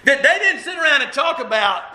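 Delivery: laughing, half-shouted insistence. Real, not acted. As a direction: push it out through a laugh. Preacher laughs, building excitement.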